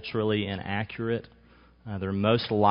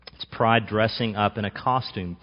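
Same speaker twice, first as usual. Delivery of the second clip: ordinary talk, nothing marked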